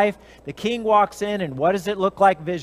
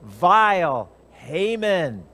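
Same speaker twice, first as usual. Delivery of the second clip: very slow, cold accusation; accusing queen; much slower than usual. Acted. Esther accuses Haman before the king, very slow. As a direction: spit the name out slowly. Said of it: "And Esther says".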